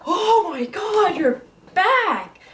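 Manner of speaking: high, slow, delighted dog voice; voicing the dog. Voicing her dog greeting her at home, slow high delight. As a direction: gush like an overjoyed pup at the door. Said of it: "They're just like".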